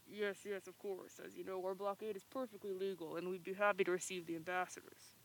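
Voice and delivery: hushed alien official; quiet viceroy voice. Acted. Trade Federation viceroy.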